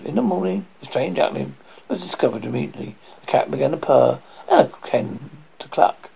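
plain reading voice

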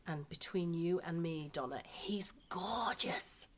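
hushed, drawn-out confession of a crush; confiding whisper. Acted. Wife confides to the novelist about her golf instructor, confiding whisper. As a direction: lean in and breathe it like a secret.

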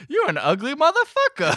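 high, loud mock outrage; mock indignant bartender. Retelling bartender's insult.

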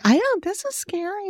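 high, soft, slow mock-scared voice; hushed high impression of fear; imitated fright. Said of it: "She was really scared".

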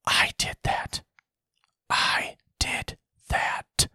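slow, drawn-out stage whisper; hushed mock whisper, word by word. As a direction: whisper it slowly into the mic, long gaps between phrases. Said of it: "whispering in the microphone".

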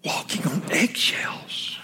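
soft whispered echo. Real, not acted. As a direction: let the phrase sink in a whisper. Echo of the wife's words.

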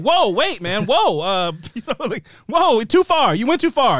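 high, loud objection; alarmed whoa. Retold or imitated. Viewer watching Mitch threaten Gail, alarmed. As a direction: pull back, too far.

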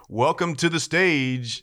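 slow, drawn-out announcer voice; lightly voiced club emcee; strip club announcer. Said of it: "he literally said every time".

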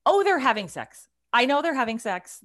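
knowing, forceful comeback to a husband; emphatic insistence. Retold or imitated. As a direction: insist it loudly, as if nobody can fool you. Retold argument with husband, insisting.

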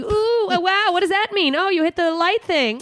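high, gushing mock wonder; fake enthusiasm. Friend mocks her fake interest in his pinball, gushing. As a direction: pretend to be thrilled by his game. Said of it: "I have to pretend I like it too".

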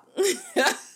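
real amused laugh; high laugh. Real, not acted. Laughing at her own joke.

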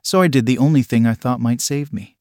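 ordinary delivery, nothing marked

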